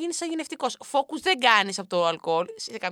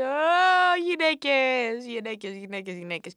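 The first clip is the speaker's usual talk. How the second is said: mock drunk lament; 4 dB louder, much slower than usual.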